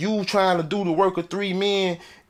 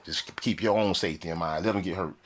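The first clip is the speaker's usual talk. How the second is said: muttering; 9 semitones lower, 7 dB quieter than usual. Retold or imitated. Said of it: "So I'm like".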